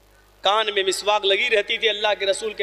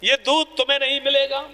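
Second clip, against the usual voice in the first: raised, fervent sermon voice driving the story; impassioned preaching; 7 semitones higher than usual. Acted. Preacher to his brothers, retelling the caliph's stand, fervent. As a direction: preach it with rising urgency.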